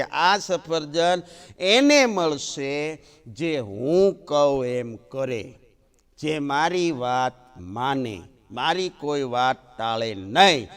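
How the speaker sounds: slow, weighty laying down of the rule; slow and deliberate. Acted. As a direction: spell out the condition word by word. Mother laying down rule slowly. (માની ધીમી, ભારપૂર્વક શરત)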